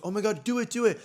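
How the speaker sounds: high, eager egging-on; excited urging. Retold or imitated. Urging Sty to go live with J T, excited. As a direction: act out thrilled urging.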